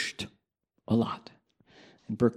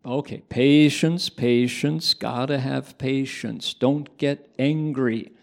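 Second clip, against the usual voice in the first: slow, louder chanted mantra; repeated mantra; 2 semitones higher, 4 dB louder than usual. Retold or imitated. Monk repeating the patience mantra for Doug. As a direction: chant it again for him. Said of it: "Okay, recite it back".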